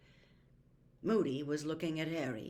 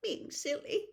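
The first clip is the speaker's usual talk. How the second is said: higher, tremulous mumble; tearful mutter; 12 semitones higher than usual. A narrator's reading. Missus Weasley mutters to herself, still tearful. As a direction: mumble it to herself through tears. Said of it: "she muttered again".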